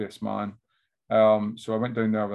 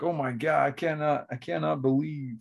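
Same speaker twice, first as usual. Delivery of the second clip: higher mock American accent; Kermit the Frog; 5 semitones higher than usual. Amazed American guy in Thailand, Kermit-like American accent. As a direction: put on a froggy American accent. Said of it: "I went out and Kermit the Frog there".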